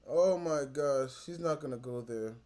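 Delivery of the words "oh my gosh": loud, slow exasperated groan. Real, not acted. Podcast host loudly exasperated at a cohost's argument. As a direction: drag out the disbelief loudly.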